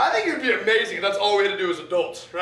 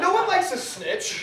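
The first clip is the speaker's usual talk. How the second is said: mocking mom; 8 semitones higher than usual. Mom voiced in story.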